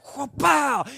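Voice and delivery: high, loud kung-fu shout; loud movie-style karate yell. Retold or imitated. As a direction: leap in with a karate cry. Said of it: "jumped in the air and went".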